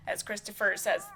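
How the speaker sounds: ordinary talk, nothing marked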